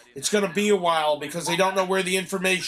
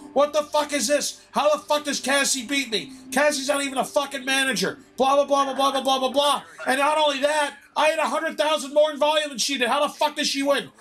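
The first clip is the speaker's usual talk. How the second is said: high-pitched, indignant ranting; retold angry rant; 6 semitones higher than usual. Showing the manager the paper, high-pitched retold rant. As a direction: act out a dream tirade without full shouting. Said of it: "And I'm screaming".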